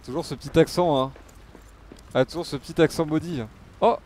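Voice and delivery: usual speaking voice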